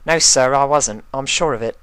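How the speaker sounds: put-on a startled young spy reassuring his boss; nervous colt.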